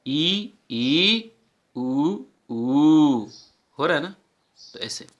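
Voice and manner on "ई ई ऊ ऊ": ई ई and ऊ ऊ rising, drawn-out vowels; vowel pairs demo. Shown as a demonstration. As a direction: contrast short and long ई and ऊ. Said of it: "तो ये हो गया ना". (छोटी और बड़ी ई, ऊ का अंतर)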